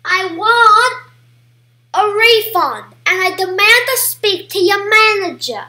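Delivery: loud, put-on Karen demanding service. Customer demanding a refund from the manager, mock-indignant Karen. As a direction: play an irate customer, hammed up and loud. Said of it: "Hi, this is Karen here".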